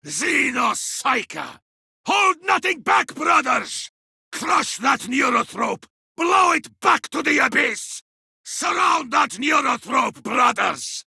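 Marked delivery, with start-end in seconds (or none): 2.1-11.0 rallying shout to the squad; battle shout. Acted. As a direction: shout the orders with full force. Rallying squad against enemy.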